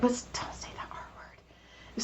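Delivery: hushed whispered correction. Real, not acted. Telling a friend not to say a word, whispered. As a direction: whisper it as a quick aside.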